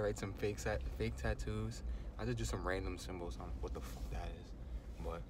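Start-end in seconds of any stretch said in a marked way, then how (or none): 3.0-5.2 slow hushed whisper. Real, not acted. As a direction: whisper so nobody hears. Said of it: "I know I'm whispering".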